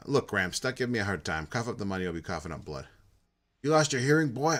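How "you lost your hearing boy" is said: put-on loud, raised old man's bark; angry yell. Cranky old shopkeeper yelling at the thug collecting tribute, loud and angry. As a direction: shout him down like a cranky old man.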